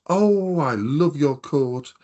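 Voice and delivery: high, loud, drawn-out admiring coo; imitated gushing girl. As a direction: gush like an admiring girl, stretching the words. Girl gushing over his coat.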